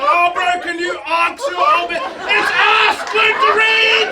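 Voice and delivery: mock shouted scolding. Mocking heckler correcting pronunciation.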